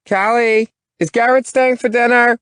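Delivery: high, loud calling mother; mother calling through wall. Acted. Mother calling her daughter through the bedroom wall, high and loud. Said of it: "Missus Carpenter's raspy voice punches through".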